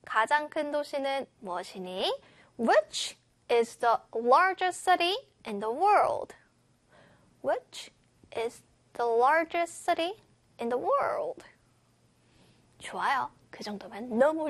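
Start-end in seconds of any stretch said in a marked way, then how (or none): 2.6-6.3 slow, pauses after "Which" and "largest city"; careful chunk-by-chunk model reading. Shown as a demonstration. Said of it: "잘라 잘라 읽으면 쉬울 겁니다". 7.4-11.4 separated chunks for learners to follow; read in chunks. Shown as a demonstration. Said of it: "잘라 잘라 읽으면 쉬울 겁니다".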